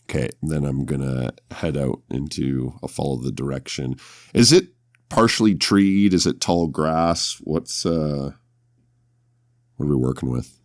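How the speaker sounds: ordinary delivery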